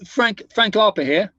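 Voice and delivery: slow, imitated East End of London gangster accent; Frank Harper East End accent. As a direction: lightly put on London gangster introducing himself. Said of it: "East End of London gangster accent".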